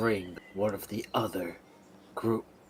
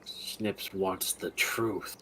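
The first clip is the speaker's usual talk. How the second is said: slow menacing demand; much slower than usual. Acted. Snips demanding the truth from Riolus, slow and menacing. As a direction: drag each word out to scare him.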